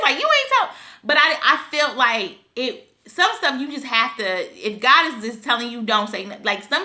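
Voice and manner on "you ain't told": shocked coworkers. Retold or imitated. Coworkers shocked she hadn't told.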